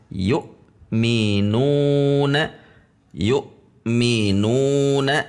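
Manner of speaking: "Yu'minuna" with an abrupt catch after yu, then slow minuna; hamzah tertahan. Shown as a demonstration. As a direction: stop the breath on the hamzah of "yu'minuna", then resume. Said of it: "membacanya langsung berhenti seperti terpisah". ("yu'minuna" dengan hamzah tertahan)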